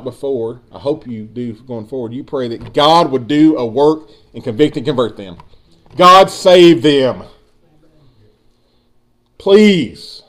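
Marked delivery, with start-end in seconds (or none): 6.0-10.2 slow, loud earnest plea; pleading prayer. Real, not acted. Pastor begging God to save loved ones, slow and loud. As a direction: beg God for them, drawn out. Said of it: "pleading prayer".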